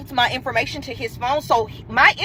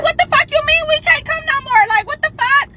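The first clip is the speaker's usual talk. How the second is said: high, indignant comeback; retold outraged disbelief; 11 semitones higher than usual. Challenging the boy who cancelled on her, high retold outrage. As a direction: throw it back at him, pitched way up.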